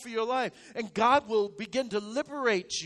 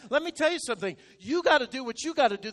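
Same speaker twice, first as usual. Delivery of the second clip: ordinary talk, nothing marked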